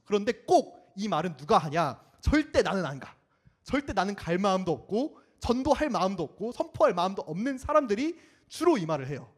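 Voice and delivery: usual speaking voice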